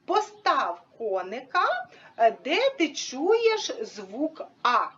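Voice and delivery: put-on slow, measured question to a child; drawn-out teaching voice; teacher's prompt. Shown as a demonstration. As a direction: spell out the task slowly for a child. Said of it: "ми говоримо".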